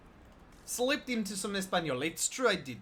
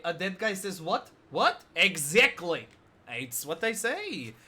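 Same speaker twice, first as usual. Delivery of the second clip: normal talking voice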